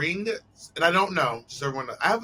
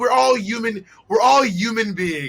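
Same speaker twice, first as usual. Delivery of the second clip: loud, high New York "human" without the h; New York accent; 6 semitones higher, 6 dB louder than usual. Shown as a demonstration. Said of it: "New Yorkers say huge and human".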